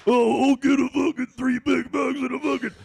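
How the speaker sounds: high, loud dopey customer voice; mocking impression. A McDonald's worker mocking a drive-thru customer on the headset, high loud parody. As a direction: ham up the rude customer. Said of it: "do an impression of that person".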